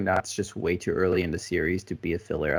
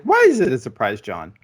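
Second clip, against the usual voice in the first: re-enacted incredulity; 3 semitones higher, 7 dB louder than usual. Replaying own reaction for John, mock incredulous. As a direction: pitch shoots up, then drops on the name. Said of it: "I'm like".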